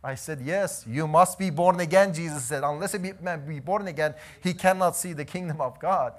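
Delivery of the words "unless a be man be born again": usual speaking voice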